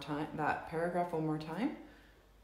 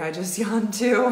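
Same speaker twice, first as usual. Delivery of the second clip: real laugh breaking through, louder; 5 semitones higher, 8 dB louder than usual. Real, not acted. Host laughs to Valerie about yawning too, laughing loudly.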